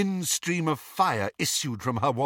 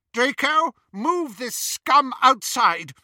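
high, loud, slow order; imperious command; 6 dB louder, slower than usual. A narrator's reading. Bellatrix sending Draco out with prisoners, imperious command. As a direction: order him loudly, pitched up.

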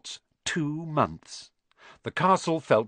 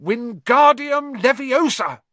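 loud, slow, flailing spell shout; 12 semitones higher, 7 dB louder, slower than usual. A narrator's reading. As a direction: bellow the spell, drawn out. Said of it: "he shouted".

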